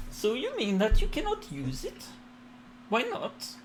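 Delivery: high, lilting noblewoman; lady character voice. Acted. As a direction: speak as a curious goddess.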